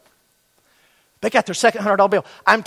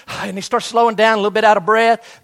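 usual speaking voice

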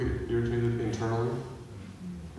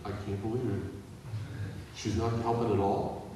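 inner grumble; 7 dB quieter than usual. Retold or imitated. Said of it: "imagine Martha internally".

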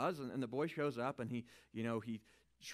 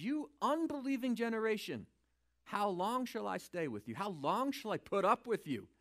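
higher, louder exasperated rebuke; exasperated Jesus scolding the crowd, voice raised; 7 semitones higher, 4 dB louder than usual. Retold or imitated. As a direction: scold them, fed up and raised. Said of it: "I think he's getting a little exasperated".

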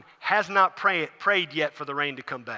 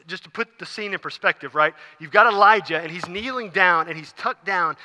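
ordinary talk

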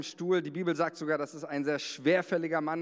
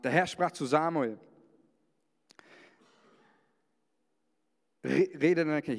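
raised, solemn Bible reading; scripture reading. Acted. Pastor reading First Samuel to the congregation, solemn. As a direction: read the verse like a proclamation.

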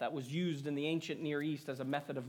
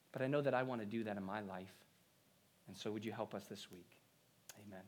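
soft, subdued prayer, sinking lower toward the end; closing prayer; 3 semitones lower, 5 dB quieter, faster than usual. Real, not acted. Pastor leading the congregation in prayer for help this week, hushed. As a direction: pray quietly, settling down to the amen.

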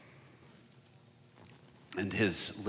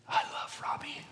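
hushed, voiceless imitation of weeping devotion; mock tearful; 5 semitones higher, 4 dB quieter than usual. Gang leader moved by his girlfriend's words, mock tearful. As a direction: whisper it as if choked up with adoration. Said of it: "through his tears and says".

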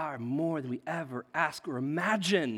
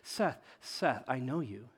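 God's gentle voice; 4 semitones lower, 5 dB quieter than usual. Retold or imitated. God softly reassuring the preacher, low and quiet. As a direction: speak softly as God calming him. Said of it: "He's like".

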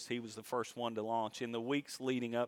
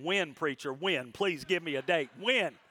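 impatient pleading; 6 semitones higher, 4 dB louder than usual. Retold or imitated. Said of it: "I can hear some thoughts out there saying".

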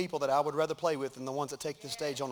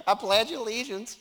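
laughing mock prayer; 4 semitones higher, 3 dB louder, slower than usual. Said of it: "when she's saying the prayer".

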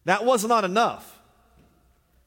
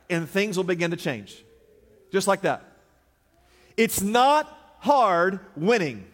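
usual speaking voice

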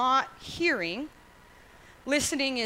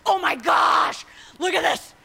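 excited Jesus; 5 semitones higher, 8 dB louder than usual. Acted. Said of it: "And he says".

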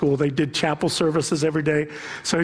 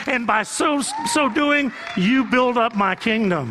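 full-force preaching; 8 semitones higher than usual. Acted. Pastor voicing God's charge to the church, shouting preaching. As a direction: thunder God's command over the pews.

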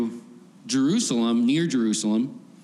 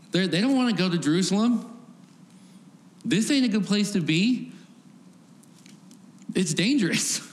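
higher-pitched, mildly nervous protest; nervous disciples; 7 semitones higher than usual. Acted. Disciples balking at going to Jerusalem, mildly nervous. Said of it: "a reflection of the nervousness".

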